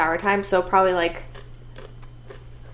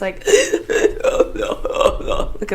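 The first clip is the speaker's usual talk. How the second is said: breathless gasp. Retold or imitated. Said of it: "I lose my breath for a minute".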